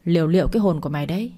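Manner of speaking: usual speaking voice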